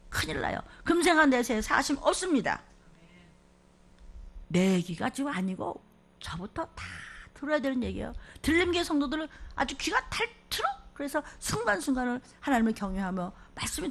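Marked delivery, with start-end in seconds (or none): none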